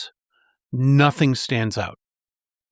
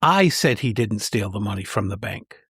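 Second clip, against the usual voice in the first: "I" punched at the very start, the rest trailing lower; emphatic "I", everything after it unstressed; stress on I; 5 semitones lower than usual. Shown as a demonstration. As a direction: lean hard on "I", then let the sentence fall away. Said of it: "listen to what happens when I emphasize different words".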